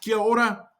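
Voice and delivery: "Kia ora" quick and run together; said fast. Shown as a demonstration. Teacher showing the greeting at speed, "Kia ora" said fast. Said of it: "Say it fast".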